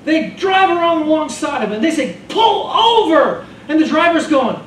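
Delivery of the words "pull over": high, loud, drawn-out command shout; barked order. Acted. Cop shouting at the ambulance driver to stop, loud slow yell. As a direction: yell it like a cop through the window.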